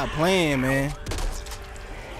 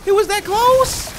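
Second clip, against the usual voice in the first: high, loud startled yell; shocked outcry; 7 dB louder, slower than usual. Real, not acted. Player finding an enemy right beside them, shocked. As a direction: voice leaping in real shock.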